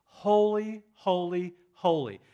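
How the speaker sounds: loud ringing holy, holy, holy; declaring God's holiness, shouted triple holy; proclaimed holy. Shown as a demonstration. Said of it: "Only with this word".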